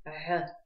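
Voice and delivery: Г as a rough throat fricative; суженные связки. Shown as a demonstration. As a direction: push air through a narrowed throat for Г. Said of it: "сужаем голосовые связки". (гортанное «Г» с сильным трением)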